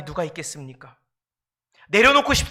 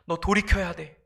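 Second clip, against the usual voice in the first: ordinary talk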